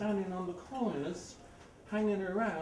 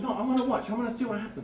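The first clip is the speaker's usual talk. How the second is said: eager onlooker; 6 semitones higher, 5 dB louder, faster than usual. Retold or imitated. Lady eager to watch.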